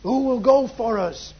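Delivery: "Who will go for us" pitched high and firm; emphatic reading. Shown as a demonstration. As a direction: read "Who will go for us" as a lifted, emphatic call. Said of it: "there's an emphasis".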